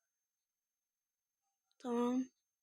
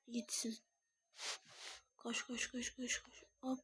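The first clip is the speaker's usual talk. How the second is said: whispered urging; 16 dB quieter than usual. Real, not acted. Urging on game character quietly.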